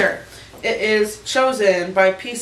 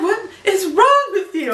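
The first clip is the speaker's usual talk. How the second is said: mock Phil; 11 semitones higher, 4 dB louder than usual. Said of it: "he's gonna be like".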